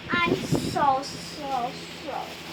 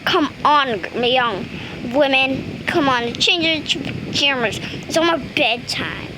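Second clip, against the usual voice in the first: normal talking voice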